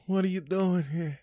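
high, far-off put-on voice of the visitors; mimicked family at door. Comedian mimicking relatives at the door, high put-on voice. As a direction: squeak it out like surprised relatives.

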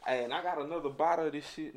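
ordinary talk, nothing marked

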